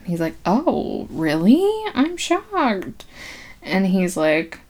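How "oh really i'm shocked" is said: mock shock. Man hearing she has no husband, mock shocked. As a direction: pitch leaping high on the feigned surprise. Feigned shock in retold scene.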